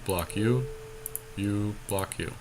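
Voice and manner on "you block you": ordinary delivery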